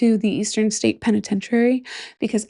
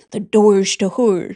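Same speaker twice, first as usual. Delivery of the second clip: doors to horror in a broad Midwest accent; 3 dB louder than usual. Shown as a demonstration. Host testing the phrase in a Midwest accent. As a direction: lean into the Midwest vowels on horror. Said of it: "Try to say that with this Midwest accent".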